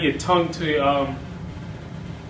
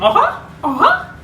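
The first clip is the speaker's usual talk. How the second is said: very high giggly uh-huh; Mickey Mouse chuckle; 4 dB louder than usual. Shown as a demonstration. Tutor demonstrating the Mickey chuckle. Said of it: "start repeating".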